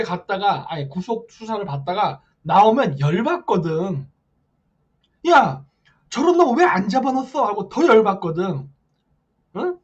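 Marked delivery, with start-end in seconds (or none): 5.3-7.4 louder, higher indignant outburst; mock outrage. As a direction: imitate an outraged supporter demanding answers. Voices outraged conservatives. (격분한 지지자를 흉내 낸 고함)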